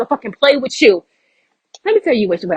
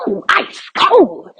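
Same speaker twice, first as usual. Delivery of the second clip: high, slow, relishing the burn; a drawn-out playful ooh; teasing ooh; 9 semitones higher, much slower than usual. Real, not acted. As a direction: savor it like a roast landed. Reacts to own harsh quote.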